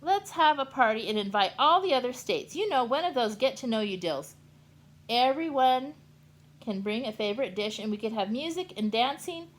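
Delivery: loud high eager plan; loud bubbly invitation; excited pitch. Acted. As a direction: sell the party idea. Kansas pitches party idea.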